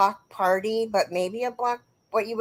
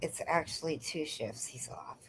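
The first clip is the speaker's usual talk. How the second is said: soft unvoiced whisper; 6 semitones lower, 16 dB quieter than usual. Real, not acted. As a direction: hush it as if it were a private aside. Repeats a viewer's comment, hushed.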